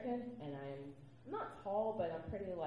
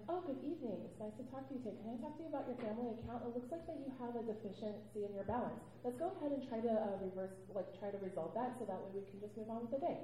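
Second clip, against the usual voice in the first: higher, bright-toned polite phone manner; customer service phone voice; 5 semitones higher than usual. Retold or imitated. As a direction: sweet-talk a caller about their balance. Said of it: "I sound kind and natural".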